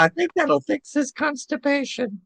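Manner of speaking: put-on deadpan little-kid comment; wry kid voice. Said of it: "I said".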